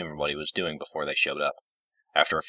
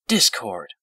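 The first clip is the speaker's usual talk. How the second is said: higher, louder reproach; chiding; 5 semitones higher, 5 dB louder than usual. A narrator's reading. Fluttershy chiding Discord for rudeness, reproachful. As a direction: scold him by name. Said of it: "Fluttershy chided him".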